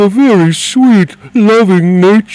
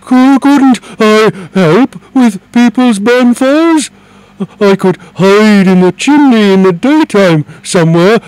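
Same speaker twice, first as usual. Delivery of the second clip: excited; 9 semitones higher, 9 dB louder than usual. Acted. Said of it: "said the dragon excitedly".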